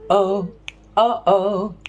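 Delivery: "oh" clicked at each start; vocal drill on "oh" with glottal starts; glottal onset. Shown as a demonstration. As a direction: give each "oh" a firm, separate onset. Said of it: "known as a glottal onset".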